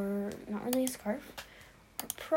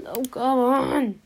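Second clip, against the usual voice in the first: frustrated groan; 3 semitones higher, 9 dB louder, slower than usual. Real, not acted. Can't find a headband.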